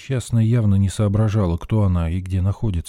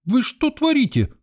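high shrieky protest, held back in volume; indignant yell. A narrator's reading. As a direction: yelp in outrage from behind. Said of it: "завопил кто-то за моей спиной".

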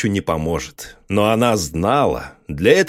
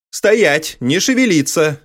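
sharp command, higher, firm; 5 semitones higher than usual. A narrator's reading. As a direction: order them to freeze. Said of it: "скомандовала она". (командным голосом)

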